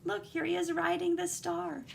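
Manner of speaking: usual speaking voice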